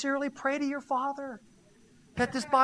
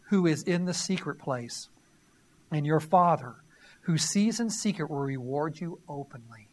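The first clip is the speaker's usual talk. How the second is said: firm, louder reading of scripture; 3 semitones lower, 3 dB louder than usual. Acted. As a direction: proclaim the verses to the congregation. Reads Matthew six aloud.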